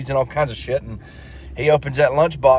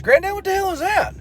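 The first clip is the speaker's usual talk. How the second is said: put-on much higher child voice; little kid voice. Johnny asking about the cigar, high childlike. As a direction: squeak it like a little boy.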